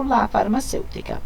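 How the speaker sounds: ordinary talk, nothing marked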